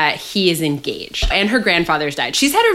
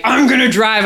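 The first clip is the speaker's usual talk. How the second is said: higher, louder mock-macho announcement; mock macho guy; 5 semitones higher, 5 dB louder than usual. Guys taking over the driving, mock macho. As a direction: imitate a guy taking charge, exaggerated. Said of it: "when guys are like".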